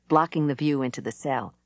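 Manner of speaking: usual speaking voice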